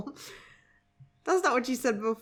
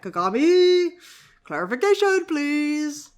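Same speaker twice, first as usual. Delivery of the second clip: loud, high, drawn-out plea; mock-demanding high shout; calling out; 7 semitones higher, 9 dB louder, slower than usual. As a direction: holler up to the author for an answer. Calls out to the author.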